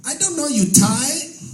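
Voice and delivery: high, slow mock accent of the masseuse; a lightly accented masseuse, said slowly; comic imitation of the masseuse's broken English; imitating masseuse. Masseuse voiced in standup story.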